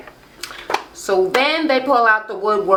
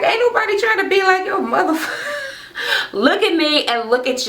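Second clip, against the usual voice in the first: squealed high-pitched comeback; hammed-up quarrel voice, near laughing; mock outrage; 13 semitones higher than usual. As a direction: imitate an indignant retort, way up high. Acting out reality-show quarrel.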